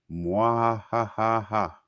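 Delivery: slow hammed-up mwahaha; evil villain laugh. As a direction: cackle like a cartoon villain.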